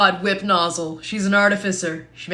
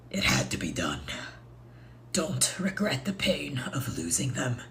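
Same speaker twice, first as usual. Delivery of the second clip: lower, hushed whisper; solemn whisper; 6 semitones lower, 7 dB quieter than usual. Acted. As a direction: soothe her in a grave whisper. Solemn in-character consolation.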